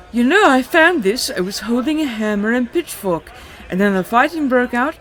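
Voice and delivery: high and loud, hammed-up elf squeal; horrible high elf voice. Narrating the recap, voicing the elf on the stone: high shrill elf. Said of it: "in a horrible high elf voice".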